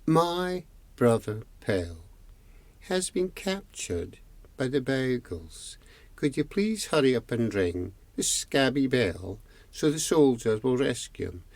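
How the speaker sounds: over-enunciated plea, spaced out and fairly quiet; slow and careful. Acted. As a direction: spell it out patiently for someone who can't understand. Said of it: "I can't understand what you're saying".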